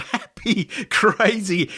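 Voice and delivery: usual speaking voice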